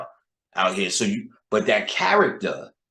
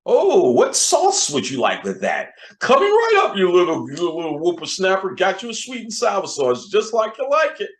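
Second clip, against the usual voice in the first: much higher and louder, hammed-up sweet server; over-sweet cashier; 9 semitones higher, 5 dB louder than usual. A fast food worker performing for the boss, hammed-up sweetness. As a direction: lay the customer service on thick. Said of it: "boss is in the building".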